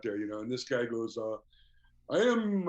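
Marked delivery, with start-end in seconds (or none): 2.1-2.7 higher, louder, lightly voiced Russian fan; a hint of the earnest Moscow visitor, pitched up; imitating the Russian fan. Fan from Moscow introducing himself to the band, lightly imitated.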